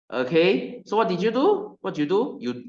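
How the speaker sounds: normal talking voice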